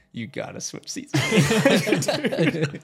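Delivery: high, slow, laughing through the words; laughing plea. Retold or imitated. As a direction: beg your friend while cracking up.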